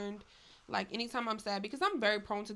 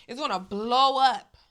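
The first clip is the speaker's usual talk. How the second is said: slow and much louder, blowing it up; big emphatic exclaim; 2 semitones higher, 12 dB louder than usual. Real, not acted. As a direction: let it burst out loud and slow. Suppressed emotion exploding.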